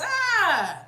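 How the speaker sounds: high, loud drawn-out "ah"; mock outburst. Mother reenacting her near-slap of son, mock cry. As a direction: cry out as if acting on reflex.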